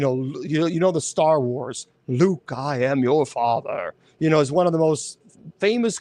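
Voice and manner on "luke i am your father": Vader line. Retold or imitated. Quoting the famous Star Wars line.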